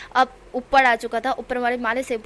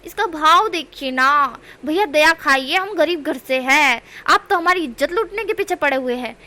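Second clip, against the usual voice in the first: loud pleading; 4 semitones higher, 6 dB louder than usual. A narrator's reading. Begging the vendor for mercy.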